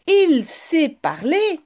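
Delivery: put-on slightly high, slow and loud excited child. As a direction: Jip amazed that the parrot talks. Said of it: "dit Jip". (voix d'enfant émerveillé, légèrement aiguë)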